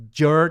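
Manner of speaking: "jur-" held long on the English r; isolated, drawn-out "jur-"; syllable demonstration. Shown as a demonstration. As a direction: model "jur-" slowly, alone. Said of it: "é tipo assim".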